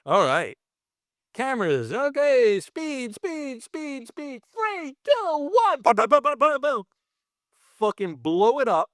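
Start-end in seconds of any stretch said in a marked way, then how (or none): 1.9-4.4 high called-out speed; calling speed. Retold or imitated. Effects boss calling speed to the cameras, high call. 4.5-6.8 high excited countdown into booms. Retold or imitated. Effects boss counting down to the blast, high excited.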